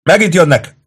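high, loud cry of alarm; shouted warning. A narrator's reading. Soldier to comrades at the gate, shouting. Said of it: "kiáltotta valaki".

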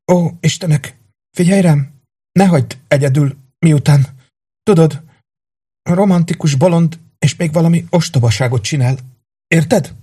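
put-on high, urgent plea of a wounded girl; pleading dying woman. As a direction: beg him with fading strength. Haldokló lány könyörög. (magas, sürgető könyörgés)

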